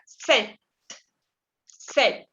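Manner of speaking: "Sept" said without P, ending on T; silent P. Shown as a demonstration. Teacher showing French seven, silent P. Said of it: "La P no se pronuncia".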